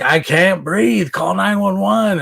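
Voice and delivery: desperate plea. Retold or imitated. Man who cannot breathe begging his friend to call for help, slow and strained. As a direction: drag out each word as if gasping for air.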